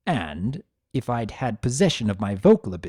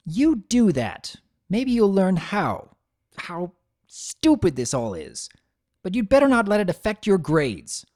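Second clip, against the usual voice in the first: bitter retort; 7 semitones higher than usual. Acted. Mother retorts while leaving.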